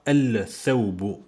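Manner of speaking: "Al-Thawbu" with a full, heavy L before th; clumsy al-thawbu, L clearly heard; L wrongly pronounced. Shown as a demonstration. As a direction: sound the L in "Al-Thawbu": al-thawbu. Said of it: "parce que c'est trop lourd".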